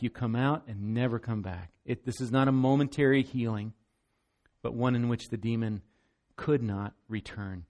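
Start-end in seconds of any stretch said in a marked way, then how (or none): none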